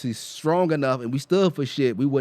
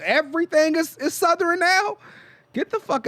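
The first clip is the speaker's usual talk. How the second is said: incredulous. Real, not acted. Mocking disbelief.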